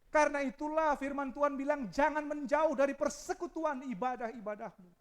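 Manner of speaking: high, loud impassioned sermon; full-force preaching. Acted. Preacher to congregation, impassioned loud preaching. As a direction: preach it hard.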